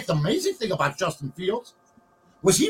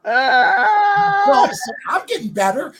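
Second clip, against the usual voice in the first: mock cry shout; 12 semitones higher, 6 dB louder, much slower than usual. Mock cry shout after joke.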